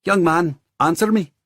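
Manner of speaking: put-on high, loud older woman's voice; stern older woman. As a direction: demand an answer, firmly. Landlady demands an answer.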